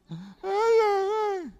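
high, whiny fake sobbing ay ay; husband mocked as a crybaby, wailing; mock crying wail. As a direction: ham up a grown man's wail like a little girl. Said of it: "llorando como una nena".

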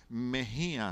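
Mejías with a clear final s sound. Shown as a demonstration. Preacher showing his surname with the s. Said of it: "me veo demasiado elegante".

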